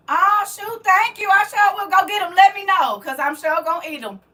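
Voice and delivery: high, loud delighted outburst; thrilled, high-pitched gratitude; excited thanks. Real, not acted. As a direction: gush with real excitement. Thanks a viewer for offered food.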